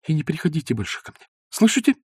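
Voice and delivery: dry, offended goodbye; offended Zosya dismissing Ostap dryly; curt. A narrator's reading. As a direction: cut him off coldly. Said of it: "сухо молвила Зося".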